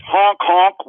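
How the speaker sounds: honking like a clown horn; clown honks and squeaks. Pretend. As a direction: honk it out like a circus clown. Mocking government as clown show.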